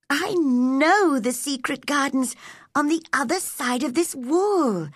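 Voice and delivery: put-on high, excited girl's thought. A narrator's reading. Mary realises where the secret garden is, excitedly. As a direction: think aloud with high-pitched excitement. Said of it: "she thought excitedly".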